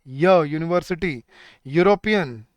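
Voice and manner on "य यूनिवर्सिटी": slow "य" on its own, then "यूनिवर्सिटी"; isolated opening sound. Shown as a demonstration. Teacher sounding out for students, slow separate "य" before "यूनिवर्सिटी". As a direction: stretch "य" as yuh before saying "यूनिवर्सिटी". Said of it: "यूनिवर्सिटी बोल के देखो एक बार".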